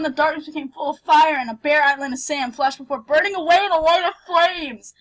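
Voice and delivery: ordinary delivery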